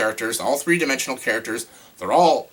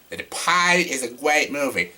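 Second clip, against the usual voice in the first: badly faked Boston accent, R's dropped; terrible Boston accent; 3 semitones higher than usual. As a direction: put on a silly Boston accent. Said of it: "my terrible Boston accent".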